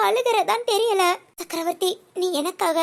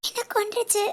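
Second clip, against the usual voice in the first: much softer, the words almost dying away; broken murmur; 2 semitones higher, 7 dB quieter than usual. Acted. To Chakravarthi, repeating that one word killed her, faint and crushed. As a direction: let it fall to a hurt hush.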